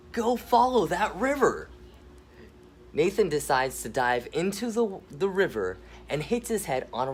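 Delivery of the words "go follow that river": upbeat uncle voice. Acted. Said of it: "Gabe says".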